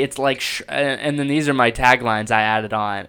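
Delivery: ordinary talk, nothing marked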